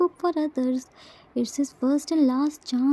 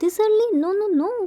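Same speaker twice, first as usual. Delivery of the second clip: shocked; 5 semitones higher, much faster than usual. A narrator's reading. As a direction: gasp it out in disbelief. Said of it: "Jin, shocked".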